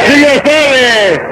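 high, long-stretched catchphrase; drawn-out call. Real, not acted. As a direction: call it out like a show host. Host calls out catchphrase. (pregón agudo y alargado)

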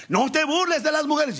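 high, scolding mother's voice; a mother warning her son, pitched high; stern mother. Retold or imitated.

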